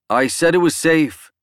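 loud, high booming declaration. Acted. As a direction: boom it out to the crowd. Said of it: "Dan boomed".

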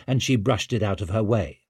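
plain reading voice

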